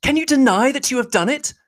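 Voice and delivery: Elizabeth, indignant. Acted. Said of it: "she repeated".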